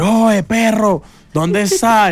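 Diana calling. Retold or imitated. Mimicking Diana phoning her friend, high and slow. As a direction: stretch it out like a friend calling on the phone.

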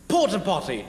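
porta potty in posh British accent; high-class British accent. Shown as a demonstration. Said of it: "in a high-class British accent".

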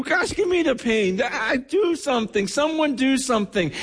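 high-pitched, urgent plea; desperate pleading. Acted. Visitor hearing a loved one in pain, desperately pleading. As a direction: plead helplessly for someone to act. Acting out helpless pleading bystander.

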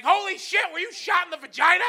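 mock shock; shocked outburst. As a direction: react in horrified disbelief. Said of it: "just like".

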